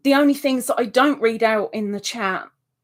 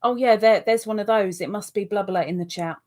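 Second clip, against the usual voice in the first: ordinary talk, nothing marked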